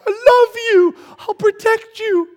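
high, loud gushing promise; mock loving declaration, high and loud; gushing God. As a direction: gush like an overeager parent. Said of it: "he's like".

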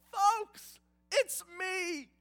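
very high, loud, beaming announcement; joyful reveal. Retold or imitated. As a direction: burst out with the big reveal. Joseph revealing himself to brothers.

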